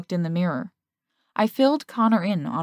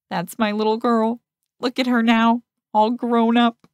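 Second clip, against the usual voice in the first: hammed-up, louder and higher teary dad; mock proud father; 4 semitones higher, 5 dB louder than usual. Friend teasing the couple after their kiss, mock proud father. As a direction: play the sappy parent for laughs. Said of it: "He dabbed at his pretend tears".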